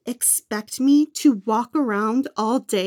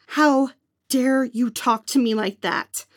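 ordinary talk

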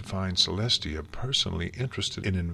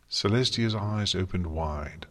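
ordinary delivery, nothing marked

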